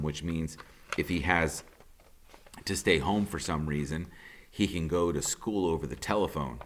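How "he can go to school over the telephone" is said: usual speaking voice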